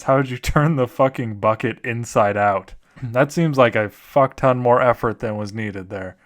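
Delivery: ordinary talk, nothing marked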